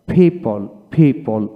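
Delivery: "people" said with the O silent. Shown as a demonstration. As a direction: say "people" as PEE-pul, leaving out the O. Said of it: "ও উচ্চারণ হবে না".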